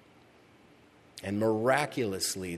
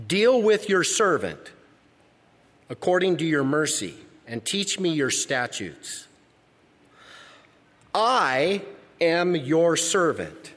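higher, louder, slow pleading psalm; fervent scripture reading; 5 semitones higher, 5 dB louder than usual. Acted. Pastor reading the psalmist's prayer aloud, fervent and slow. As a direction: plead the verse slowly to God.

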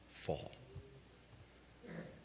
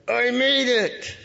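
high, loud, slow shout of relief; triumphant cry; 11 semitones higher, 7 dB louder, slower than usual. Shown as a demonstration. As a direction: yell it like the last car payment is done. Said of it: "the cry of victory".

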